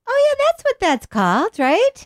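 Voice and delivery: very high-pitched "aha" moment; mock sudden realization. People when a light bulb comes on, high mock realization. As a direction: act out the light bulb coming on.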